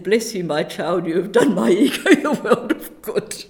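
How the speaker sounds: laughing mock benediction; mock priestly blessing. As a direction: bless her like a mock priest, cracking up at the end.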